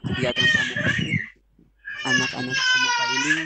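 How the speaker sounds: garbled, high warped audio; glitching call audio, pitch shooting up; breaking up space radio. Real, not acted. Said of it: "Oh, kok begini?".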